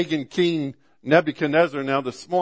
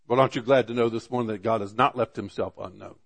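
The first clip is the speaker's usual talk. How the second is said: usual speaking voice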